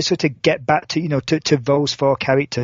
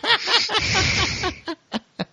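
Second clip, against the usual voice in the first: high amused laugh; 4 dB quieter than usual. Real, not acted. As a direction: burst out laughing. Admits sarcasm, then laughs.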